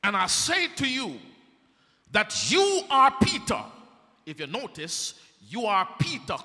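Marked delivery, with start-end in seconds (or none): 0.0-3.6 preaching. Acted. Preacher voices Jesus.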